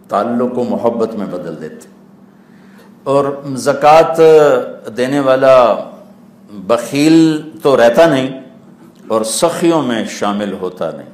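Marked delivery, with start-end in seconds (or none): 3.0-8.4 higher, louder, slow stressed words; slow emphatic preaching. Acted. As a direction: land each word heavily. Preaching point slowly stressed. (آہستہ اور زور دار)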